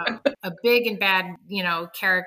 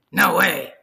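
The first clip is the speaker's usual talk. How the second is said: gruff biker pig; 7 semitones lower, 6 dB louder than usual. Acted. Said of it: "biker with a pack-a-day habit".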